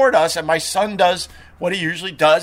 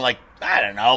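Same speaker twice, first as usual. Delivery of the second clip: normal talking voice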